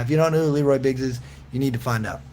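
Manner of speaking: usual speaking voice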